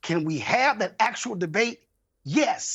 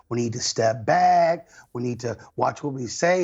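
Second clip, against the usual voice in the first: mocking quote; 6 semitones lower than usual. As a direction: drag it out, mocking his call for restraint. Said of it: "this whole".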